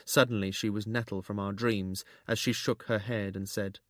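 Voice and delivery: usual speaking voice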